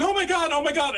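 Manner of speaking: high, loud mortified owner; imitated panic. Mortified owner running after dog.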